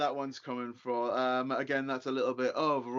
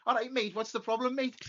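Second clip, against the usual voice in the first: high mock Scouser, all right mate; imitating a cheery Liverpool lad; playful Scouse impression; Scouse accent; 8 semitones higher than usual. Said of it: "And he was like".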